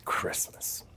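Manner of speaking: soft whispered word. Real, not acted. Whispering after losing her place. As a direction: breathe it out in a whisper.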